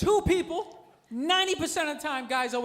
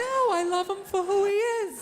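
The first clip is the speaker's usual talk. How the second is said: higher-pitched mock-sincere woman; lying gold digger; 7 semitones higher than usual. Young wife defending her old rich husband, mock-sincere high voice. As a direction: imitate a young wife protesting her love. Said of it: "they always try and lie".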